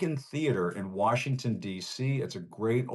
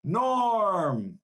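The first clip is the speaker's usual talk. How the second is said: rising cheer, Norm called out higher and louder; hearty bar greeting; 6 semitones higher, 4 dB louder, much slower than usual. Retold or imitated. Host recreating the Cheers crowd greeting Norm, hearty call. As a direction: hail Norm as the whole bar. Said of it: "greeted with a hearty".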